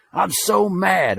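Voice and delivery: put-on high, slow stomping kid's voice; imitating a furious six-year-old. Nephew stomping in front of his uncle, high and slow. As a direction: drag it out like a mad little boy. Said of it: "he's stomping".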